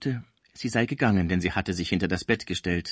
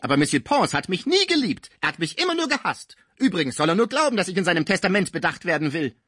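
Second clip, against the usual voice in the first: much higher and louder, venom in every word; spiteful shrill outburst; 8 semitones higher, 4 dB louder than usual. A narrator's reading. Cibot lashes out at both friends, higher and louder. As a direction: spit the words like pistol shots. Cibot complaining about Pons.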